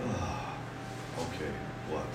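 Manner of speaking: low, very soft letdown; deflated calming down. Acted. Listener hearing "dearly beloved" in place of fighting words, deflated and quiet. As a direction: let all the fire drain out, almost under the breath. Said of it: "get you fired up more, or does it go".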